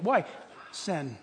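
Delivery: ordinary talk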